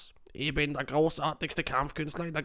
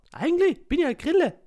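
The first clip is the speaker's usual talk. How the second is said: high squeaky little insect voice; squeaky cricket; 14 semitones higher, 4 dB louder than usual. Acted. The cricket corrects the guest, high and squeaky. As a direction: pipe up like a tiny cricket.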